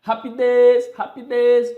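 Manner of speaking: high, loud mock pet call; calling a dog. Teacher pretends calling second puppy to eat, calling out. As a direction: holler it with the last syllable drawn out. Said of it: "vai chamá-las para dar ração".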